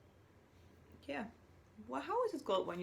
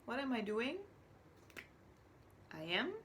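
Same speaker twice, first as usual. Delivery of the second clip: normal talking voice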